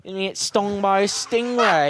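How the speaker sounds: put-on high, loud, slow Australian accent; Steve Irwin. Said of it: "What are you, fucking Steve Irwin?".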